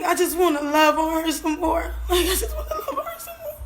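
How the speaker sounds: real sobs, voice cracking high; sobbing. Real, not acted. A mother sobbing that she wants to hold her daughter again. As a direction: wail it through tears.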